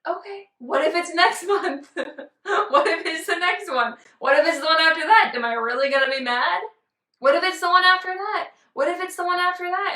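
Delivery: high, loud, giggling what ifs; giggly loud musing about next month; laughing excitement. Retold or imitated. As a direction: laugh through the hopeful questions. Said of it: "I'm like".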